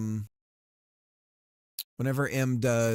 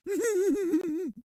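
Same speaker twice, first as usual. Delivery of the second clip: high giggle. Real, not acted. Giggling at being teased.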